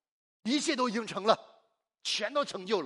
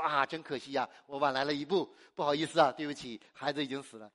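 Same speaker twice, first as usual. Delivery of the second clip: mock apology; 7 semitones lower, 3 dB quieter than usual. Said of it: "有没有说".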